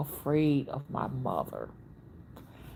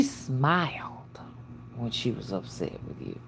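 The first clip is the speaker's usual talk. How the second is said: hushed whisper; 6 semitones lower, 8 dB quieter than usual. Real, not acted. Hushed about angry mother.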